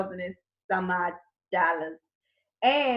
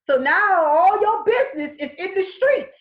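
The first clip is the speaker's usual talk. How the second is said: exclaimed; 7 semitones higher, 4 dB louder than usual. Real, not acted. Exclaiming about public debt shaming.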